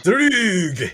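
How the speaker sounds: hammed-up Scottish accent, pitched high. Repeating the most Scottish word for laughs, hammed-up Scottish accent. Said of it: "that's the most Scottish word".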